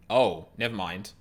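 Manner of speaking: ordinary talk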